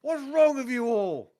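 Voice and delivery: high, furious yell, attempted Northern English; angry Northern accent. Captain looks up at his attacker, angry shouting. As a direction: shout it slowly, outraged, in a rough attempt at Northern. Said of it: "I can't do angry Northern accent".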